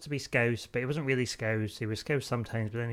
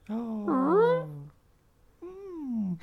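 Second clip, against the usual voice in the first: very high creature squeal; tiny alien coo, pitched way up; adipose; 6 dB louder than usual. Acted. Co-host showing off a creature impression, high-pitched squeaking. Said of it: "my impression of an adipose from Doctor Who".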